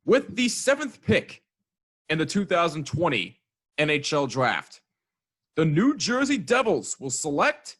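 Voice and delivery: slow, booming podium announcement; draft announcer. Acted. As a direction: announce the pick like the commissioner. Mock draft pick announcement.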